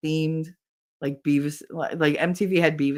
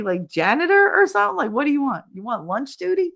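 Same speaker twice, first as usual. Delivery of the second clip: higher-pitched rhetorical questions; exasperated scolding; 9 semitones higher, faster than usual. Retold or imitated. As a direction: act out nagging a colleague. Scolding colleague, retold.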